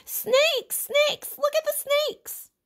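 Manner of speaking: put-on little boys shrieking in terror; screaming children. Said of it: "The little boys screamed".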